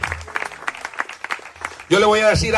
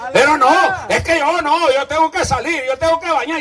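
higher, mock protesting husband; 8 semitones higher than usual. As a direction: play the flustered husband. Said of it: "Y la esposa le dice".

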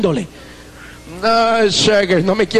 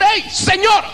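higher call across a noisy room; 10 semitones higher than usual. Retold or imitated. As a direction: hail the preacher over the crowd. Calling the preacher across room.

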